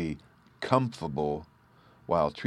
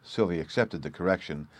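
usual speaking voice

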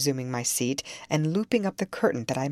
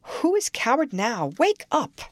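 urgent call; 8 semitones higher, 4 dB louder than usual. A narrator's reading. Nelly shaking her awake.

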